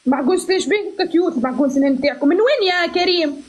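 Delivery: ordinary delivery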